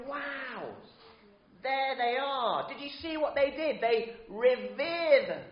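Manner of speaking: lightly voiced excited fan, high; a hint of fans cheering their team; football fans cheering. Vicar imitating fans greeting players on the pitch, mild excited cheer. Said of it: "they go".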